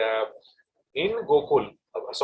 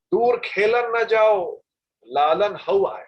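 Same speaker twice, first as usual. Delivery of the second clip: slow, higher Braj Bhasha mother's warning; 7 semitones higher, 5 dB louder than usual. Acted. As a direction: warn the child in Braj Bhasha, drawing it out. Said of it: "In Braj Bhasha, she said".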